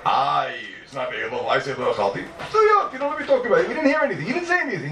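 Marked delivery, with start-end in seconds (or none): 2.5-4.9 mock indignation. Mocking an opponent's claim.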